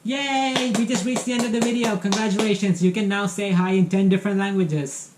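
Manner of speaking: cheery goodbye to viewers; excited outro. Real, not acted. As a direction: celebrate with the viewers. Cheerful video sign-off.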